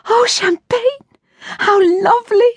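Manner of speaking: a sudden high squeal of delight; girl lit up with excitement. Acted. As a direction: gasp it out, thrilled by the champagne. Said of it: "Ruth's pretty blue eyes lit up".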